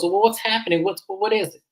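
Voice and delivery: high, mildly bewildered mimicry; confused. Imitating Mary as everyone looks around, confused. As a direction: imitate someone lost about what's happening. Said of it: "Mary's confused".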